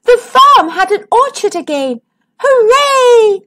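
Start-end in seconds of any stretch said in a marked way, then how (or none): 2.4-3.4 high, loud joyful cheer; excited animal cheer. Acted. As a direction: shout it like a happy farmyard crowd. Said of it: "said the animals".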